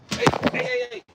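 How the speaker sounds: very high, quick repeated yelps; high-pitched warning calls; calling off the dog. Real, not acted. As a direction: scold a puppy in a sudden high squeal.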